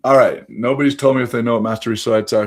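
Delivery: usual speaking voice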